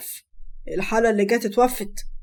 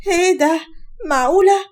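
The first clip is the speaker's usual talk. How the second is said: stunned disbelief; 6 semitones higher than usual. Acted. Mother seeing her daughter's photos, stunned disbelief. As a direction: react as if the photos hit you.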